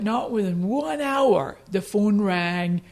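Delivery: usual speaking voice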